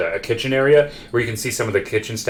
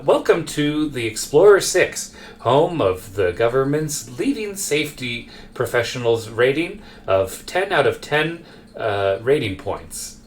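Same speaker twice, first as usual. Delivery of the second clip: slow, drawn-out recorded-welcome voice; ambient announcement voice; 3 semitones higher, slower than usual. Acted. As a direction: announce it slowly like a ship's background recording. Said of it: "background ambient voice where it's like".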